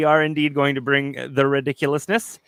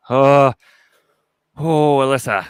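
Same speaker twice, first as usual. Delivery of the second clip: touched; 4 semitones higher, 9 dB louder than usual. Real, not acted. Touched by a viewer's message.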